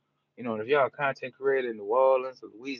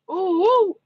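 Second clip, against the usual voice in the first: high, loud "ooh, woo"; excited whoop; 12 dB louder than usual. Real, not acted. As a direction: whoop it up for the hometown crowd. Whooping for Lake Charles viewers.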